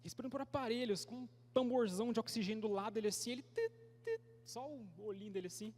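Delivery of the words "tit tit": high-pitched imitated machine beep; beep de aparelho. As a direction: squeak out two tiny electronic beeps. Imitates the oxygen machine's beeps. (bipe agudo de aparelho hospitalar imitado)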